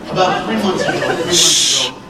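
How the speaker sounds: booming story kickoff, laughing on the repeat; big loud restart. Real, not acted. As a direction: call out over the room to start the story. Revving up the crowd.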